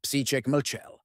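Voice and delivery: ordinary delivery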